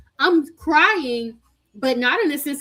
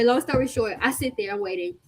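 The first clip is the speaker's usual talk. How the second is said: ordinary talk, nothing marked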